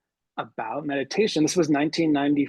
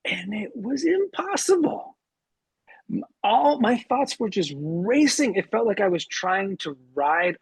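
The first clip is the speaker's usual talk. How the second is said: much higher, strained retelling; exasperated recollection; 6 semitones higher than usual. Retold or imitated. Teacher recalls his first failed try at meditating, in high-pitched exasperation.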